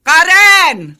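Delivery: loud call into the house. Acted. Aunt calling Karen out to face her mother, loud call. Armida calls Karen outside.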